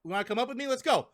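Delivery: high, eager mock Luffy; lightly voiced Luffy bursting with energy; Luffy inviting Zoro to shoot up with him, eager; excited invite. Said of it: "And he's like".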